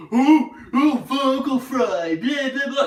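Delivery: high, loud, mocking complainer; whiny commenter. Streamer mocking viewers who complain about vocal fry, whiny. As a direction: sneer like a nagging viewer. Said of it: "people are gonna bitch and bellyache".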